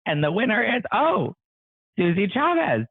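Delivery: high, loud, excited winner reveal; hyped giveaway announcement. Real, not acted. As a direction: cheer the winner's name.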